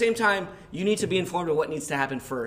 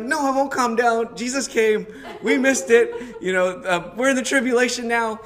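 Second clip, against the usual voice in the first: high, loud, hammed-up refusal; retold hysterical screaming; 9 semitones higher, 9 dB louder than usual. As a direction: act out screaming at the neighbors. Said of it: "while I'm screaming at them".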